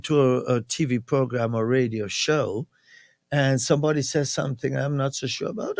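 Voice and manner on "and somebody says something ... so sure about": ordinary delivery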